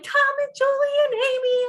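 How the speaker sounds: excited greeting. Retold or imitated. Adult group greets visitors at the door, imitated excited shout. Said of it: "they're all excited about us being there".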